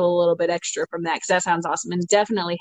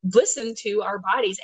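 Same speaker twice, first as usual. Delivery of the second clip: ordinary talk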